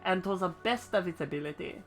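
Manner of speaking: laboured put-on French accent; bad French accent. Ishgardian instructor giving a chocobo lesson, bad French accent. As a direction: read it out in a hammy French accent. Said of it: "I'm so bad at this fucking accent".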